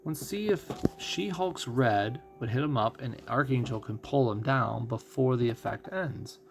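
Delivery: ordinary talk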